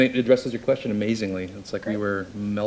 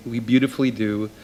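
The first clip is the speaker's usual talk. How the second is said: normal talking voice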